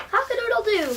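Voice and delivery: high, loud rooster crow. Acted. Rooster showing Martina his lullaby, loud crow. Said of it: "crowed the rooster loudly".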